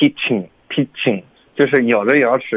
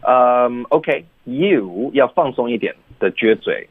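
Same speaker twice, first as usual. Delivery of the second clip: ordinary talk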